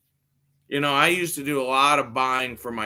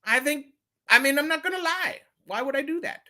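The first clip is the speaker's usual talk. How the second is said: high, mock innocent protest; pitched-up feigned innocence.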